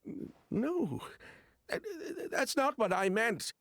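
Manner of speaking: nervous, stumbling denial, pitch jumping up; flustered stammer. Acted. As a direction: trip over the words, caught out. Cornered suspect stammering denial.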